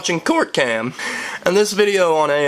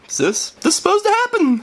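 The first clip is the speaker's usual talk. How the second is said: high, puzzled mock voice; bewildered onlooker. Onlookers looking at each other in court, mock bewilderment. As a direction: squeak it like a baffled bystander.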